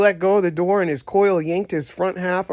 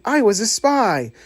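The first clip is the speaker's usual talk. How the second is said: higher, thrilled boast; proud and delighted, raise the pitch; excited; 6 semitones higher than usual. A narrator's reading. Toy thrilled after playing.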